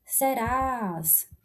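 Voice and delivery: "Serás" with a long, held stressed last syllable; stretched last syllable. Shown as a demonstration. Teacher showing the accent stays with an S, stretched last syllable. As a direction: drag the final "rás" of "Serás". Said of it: "eu puxo mais aqui a última sílaba".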